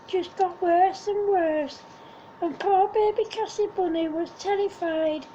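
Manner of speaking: ordinary delivery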